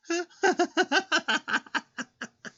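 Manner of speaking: loud high laughing fit; high burst of laughter. Real, not acted. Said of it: "I'm so sorry".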